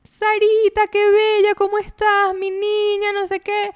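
put-on mom cooing at baby. Grandmother on FaceTime cooing at her baby granddaughter, high. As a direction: coo high and sweet at a granddaughter. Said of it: "Y dice".

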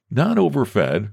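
ordinary delivery

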